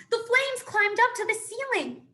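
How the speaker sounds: high and loud, alarmed; panicked shouting; frantic shout. A narrator's reading. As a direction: call it out over the fire. Broadfoot calling back mid-gallop.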